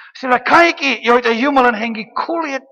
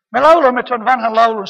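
ordinary talk

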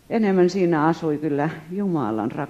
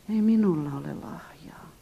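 whispered, helpless I have no gift; whispered excuse; 3 semitones lower, 5 dB quieter than usual. Retold or imitated. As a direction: breathe it out sheepishly.

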